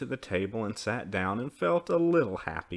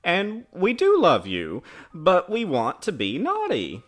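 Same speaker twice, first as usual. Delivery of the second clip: put-on high, loud childish protest; crying out; 8 semitones higher, 8 dB louder than usual. A narrator's reading. Children protest to their mother, crying out. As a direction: insist plaintively, voice raised. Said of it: "they cried".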